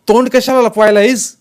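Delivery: high, loud taunting question; shouted challenge. A narrator's reading. Jaydeep taunts the man hiding his face, shouting. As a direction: shout over the noisy crowd. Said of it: "Jaydeep ओरडून म्हणाला".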